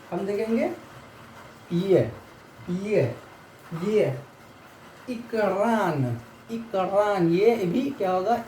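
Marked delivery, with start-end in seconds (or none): 5.1-7.3 इकरान drawn out very slowly, "e-kraan"; teacher stretches इकरान; slow pronunciation demo. Shown as a demonstration. Teacher models the Russian reversed E, slow demo. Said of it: "ई को denote होगा".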